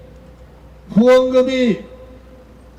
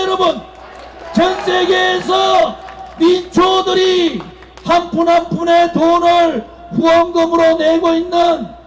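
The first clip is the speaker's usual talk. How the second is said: booming political rally oratory; rousing the crowd at full voice; roaring rallying speech; 5 semitones higher than usual. Acted.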